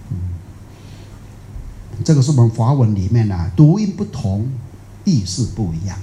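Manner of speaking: ordinary delivery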